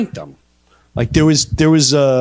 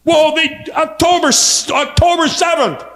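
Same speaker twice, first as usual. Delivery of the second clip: high-pitched sputtering mimicry; put-on high voice; mocking excuse-maker; 12 semitones higher than usual. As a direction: stammer it out high, as a feeble excuse. Parodies war supporters' excuse.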